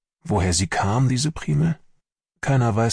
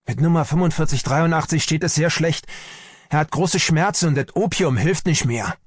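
urgent, higher-pitched report; agitated report; 8 semitones higher than usual. A narrator's reading. Medic reports a patient getting worse, agitated report. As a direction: deliver alarming news to a superior. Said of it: "rief Pelz aufgeregt".

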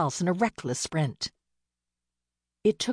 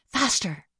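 urging; 6 semitones higher than usual. Acted. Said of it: "she said to the horse".

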